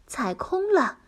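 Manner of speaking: ordinary delivery, nothing marked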